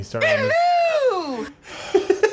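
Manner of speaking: high, slow laughing yell; laughing shout. Real, not acted.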